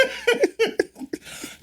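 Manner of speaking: high squeal of laughter; laugh-squeal over the word. Real, not acted. Laughing at friend's slip.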